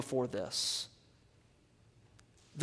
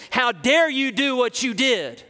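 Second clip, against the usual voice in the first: mock indignant rebuke; 6 semitones higher, 5 dB louder than usual. Imagined courtroom confrontation with murderer.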